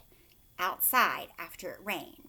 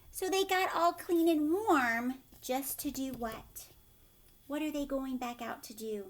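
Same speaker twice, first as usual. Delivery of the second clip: ordinary delivery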